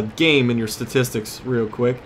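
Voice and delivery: ordinary delivery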